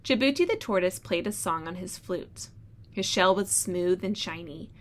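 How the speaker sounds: storybook read-aloud voice; reading to children. Acted. Teacher reads story aloud.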